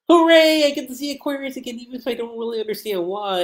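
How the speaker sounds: high, fast, gleeful cheering; excited cheer. Retold or imitated. Lucy glad to see Aquarius again, cheering giddily. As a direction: bounce with giddy joy.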